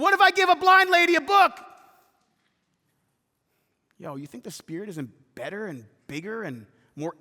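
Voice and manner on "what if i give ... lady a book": worried objection. Retold or imitated. Imagined objector.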